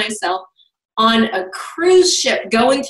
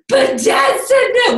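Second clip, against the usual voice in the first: whiny daughter; 11 semitones higher than usual. Retold or imitated. Daughter's voice in retold argument.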